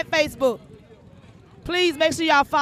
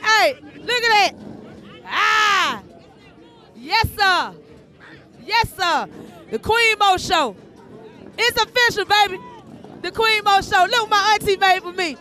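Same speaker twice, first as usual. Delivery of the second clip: higher, calling out to show off the gift; proud show-off to Big Sal; excited calling; 4 semitones higher than usual. Real, not acted. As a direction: call your friend over, proud and thrilled. Shows off gift to friend.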